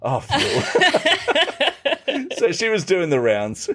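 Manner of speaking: high, laughing realisation. Real, not acted. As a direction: laugh with recognition. Laughs at the news.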